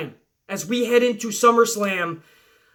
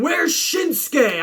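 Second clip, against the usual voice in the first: drawn-out mock yell, higher pitched; slow, stretched, higher call for Shinsuke; retold yell; 6 semitones higher, much slower than usual. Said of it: "Orton is yelling".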